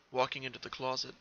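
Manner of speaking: usual speaking voice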